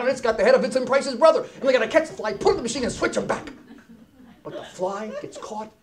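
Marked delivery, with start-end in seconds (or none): none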